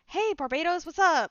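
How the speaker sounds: panting after a sprint; breathless from running; out of breath. Acted. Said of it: "out of breath from sprinting".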